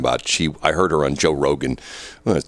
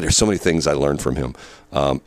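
ordinary talk

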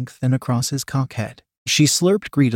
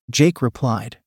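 usual speaking voice

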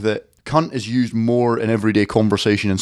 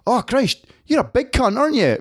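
a pub stranger voiced higher; mock stranger voice; 10 semitones higher than usual. Mate voices the guy at the bar, higher and mocking. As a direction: pitch him up as the bloke at the bar.

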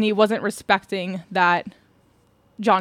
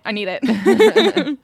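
laughing craving; 4 semitones higher, 6 dB louder, much slower than usual. Real, not acted. Gushing over wanted videos.

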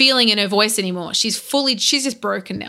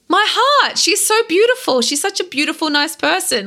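gushing; 6 semitones higher than usual. Retold or imitated. Gushing over the outhouse.

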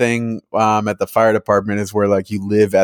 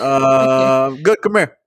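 high beckoning voice; 4 semitones higher, much slower than usual. Retold or imitated.

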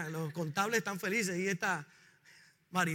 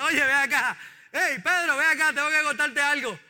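calling out; 3 semitones higher, 6 dB louder than usual. Acted. Christ calling Peter over.

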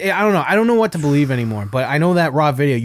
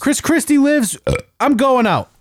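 higher, put-on parody voice; high put-on mocking voice; 11 semitones higher than usual. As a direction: keep up the high mocking act. Continues mocking voice.

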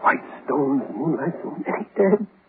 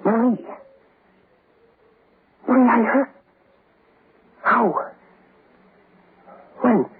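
higher, startled recognition; pitched-up shocked wonder; astonished; 9 semitones higher than usual. Acted. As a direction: gasp at the voice you hear. Hears his dead wife's voice.

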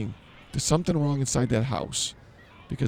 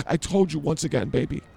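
ordinary talk, nothing marked

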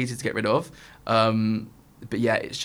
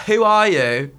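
tipsy bafflement; 7 semitones higher, 7 dB louder, slower than usual. Retold or imitated. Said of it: "I was so tanked".

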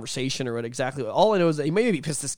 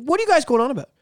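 high, loud irritated demand; annoyed, raised-voice retort; pissed-off landlord; 12 semitones higher, 5 dB louder, faster than usual. Retold or imitated. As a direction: imitate a landlord woken up angry. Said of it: "He's pissed".

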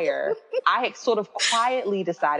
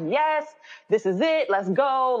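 raised pitch, mildly excited cheer; excited husband; 5 semitones higher than usual. Retold or imitated. Husband cheering on the book plan, mildly excited imitation. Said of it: "Like, he's excited".

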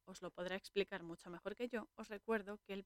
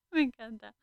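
laughing; 4 semitones higher, 11 dB louder than usual. Real, not acted. Laughing after the monk's joke.

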